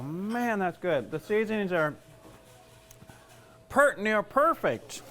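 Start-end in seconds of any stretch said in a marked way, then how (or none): 3.7-5.0 slow Southern drawl on pert near perfect; Southern talk. Shown as a demonstration. Tasting the dressing, slipping into a Southern accent. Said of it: "There's a little southern talk for you".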